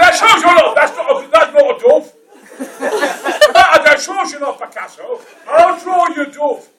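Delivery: hammed-up outraged yell, high and very loud; retold protest at full shouting pitch; indignant shouting. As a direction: bellow your scorn like an affronted child. Retold outraged child heckling Picasso.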